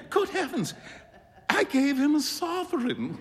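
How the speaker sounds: high, aghast half-laugh; horrified realization, crying out; dismayed cry. A narrator's reading. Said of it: "cried Huey".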